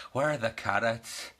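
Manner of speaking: light attempt at Irish accent. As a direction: mimic a friend's Irish carrots. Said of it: "I'm trying to do an Irish accent".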